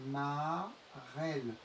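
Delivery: "marelle" very slowly, split ma... relle; "marelle" stretched out, è in the second syllable; syllable-by-syllable demo. Shown as a demonstration. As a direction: draw out "marelle" in two syllables. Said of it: "Le E ici dans marelle".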